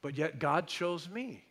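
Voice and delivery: usual speaking voice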